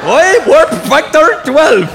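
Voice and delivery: slow, high, loud Scottish burlesque; Scotty. Acted. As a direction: drag it out in a mangled Scottish accent. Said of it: "Scotty in Star Trek was the only one".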